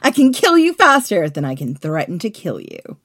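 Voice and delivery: big theatrical warning; loud threat. Acted. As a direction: declare the threat loudly, falling away. Redoing Caesar's threat, before coaching.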